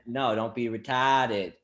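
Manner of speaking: slow, lightly voiced Boston Jesus; a hint of Boston drawl; Boston accent. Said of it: "What if Jesus had a Boston accent?".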